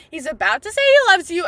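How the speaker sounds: usual speaking voice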